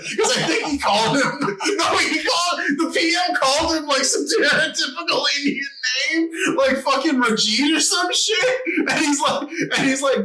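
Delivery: high excited telling; gleeful, high, laughing storytelling. Real, not acted.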